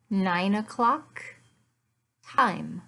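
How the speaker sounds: usual speaking voice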